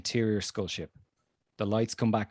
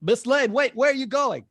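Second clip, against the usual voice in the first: put-on high, urgent young man calling after her; high-pitched call after the fleeing reporter; Jimmy calling out; 13 semitones higher, 5 dB louder than usual. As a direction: shout after her as she runs off. Jimmy calls after fleeing Lois.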